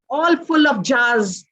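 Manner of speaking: ordinary delivery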